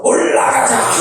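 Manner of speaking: high imitated rallying shout; congregation echoing preacher. Congregation follows the revivalist, shouting it back high. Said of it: "그럼 우리도 따라서".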